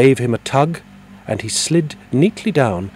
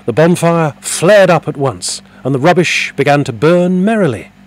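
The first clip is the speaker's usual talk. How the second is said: ordinary delivery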